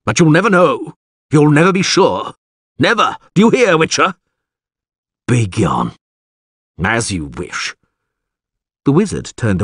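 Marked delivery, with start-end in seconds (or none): 0.1-4.1 high, loud, furious wizard; shouted. A narrator's reading. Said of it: "he shouted". 5.3-7.8 resigned dismissal. A narrator's reading. Wizard gives up, dismisses Witcher.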